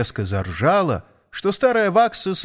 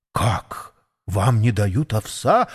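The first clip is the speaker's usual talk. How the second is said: surprised; 3 semitones lower, slower than usual. A narrator's reading. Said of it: "удивилась Мэри".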